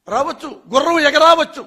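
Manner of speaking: sarcastic dismissal of a reporter's question; sarcastic scoff. Real, not acted. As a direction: scoff that horses might fly too. Sarcastic idiom.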